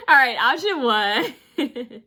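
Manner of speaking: high, loud giggling words; laughing. Real, not acted. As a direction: laugh through it slowly. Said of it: "I'm being silly".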